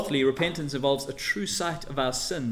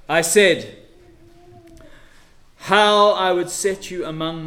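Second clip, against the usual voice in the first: preaching at full force; 3 semitones higher, 8 dB louder than usual. Acted. Preacher voicing God's grief over Israel, loud. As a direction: cry out God's longing.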